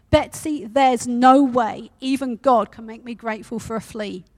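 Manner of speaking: blunt refusal, a mild flash of exasperation; cutting in. Acted. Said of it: "She cut in on her sister".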